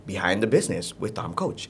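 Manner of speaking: low, subdued announcer take; low intonation. Shown as a demonstration. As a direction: drop your voice right down for the title. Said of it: "itu bisa dirubah-rubah".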